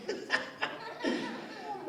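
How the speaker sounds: a high, airy burst of laughter; giggling at own joke; high breathy laugh. Real, not acted.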